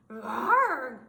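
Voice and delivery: sudden loud growling yelp; startled bear cry. Acted. Bear cries out at the oven, loud and sudden. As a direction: let out a sharp bear cry.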